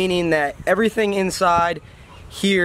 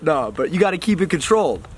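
usual speaking voice

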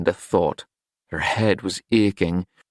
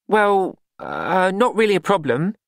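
much higher, awkward hesitant start; wretched; 11 semitones higher than usual. A narrator's reading. Glenda wretchedly evades Madame's question, high and halting. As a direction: squirm through the answer. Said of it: "began wretchedly".

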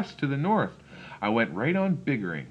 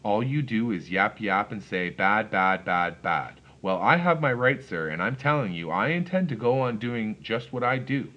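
ordinary delivery

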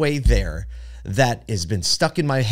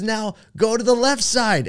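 cheerful yoga instructor; 11 semitones higher than usual. Retold or imitated. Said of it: "She goes".

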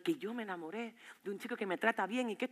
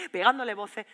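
usual speaking voice

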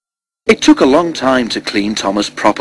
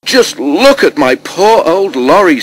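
dismayed farmer; 7 semitones higher, 4 dB louder than usual. A narrator's reading. Farmer laments his wrecked lorry.

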